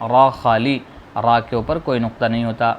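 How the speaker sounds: ordinary talk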